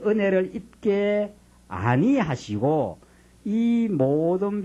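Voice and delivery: slow, drawn-out pulpit reading of Moses' plea; solemn scripture reading. Acted. Pastor reading Moses' prayer aloud, slow solemn reading. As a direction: stretch each phrase of the plea.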